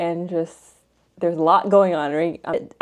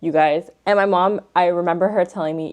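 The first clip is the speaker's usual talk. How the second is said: ordinary talk, nothing marked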